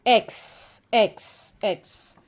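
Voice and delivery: "एक्स" whispered as a breathy ks sound, fading; whispered letter sound x. Shown as a demonstration. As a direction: phonics drill: "एक्स" whispered as ks. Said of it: "एक्स की आवाज़ होती है".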